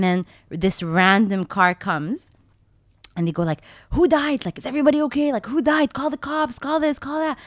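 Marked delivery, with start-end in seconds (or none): 3.9-7.4 high-pitched imitation of alarmed bystanders; mock alarm. Bystanders arriving at the crash, acted high-pitched alarm. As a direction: play a panicked onlooker, voice raised in pitch.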